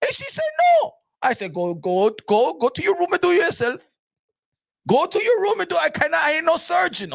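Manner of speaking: indignant makeup artist yelling; irate makeup artist. Retold or imitated. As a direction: rant as the offended makeup artist. Sustained high artist rant.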